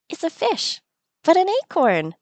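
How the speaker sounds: high, bright NPC voice; playful game character voice; chirpy villager voice. Acted. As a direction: voice the odd little villager. Voicing NPC offering food.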